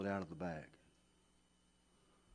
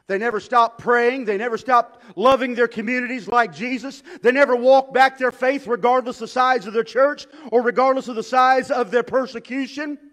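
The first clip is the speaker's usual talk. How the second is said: louder and higher, driving each line; full-force preaching; 11 semitones higher, 16 dB louder than usual. Acted. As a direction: build the list to a rousing peak.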